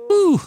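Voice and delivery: a high, loud whew at the heat; hot whew. Real, not acted. Fanning off the heat in the loft, high whew. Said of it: "it's hot in here today".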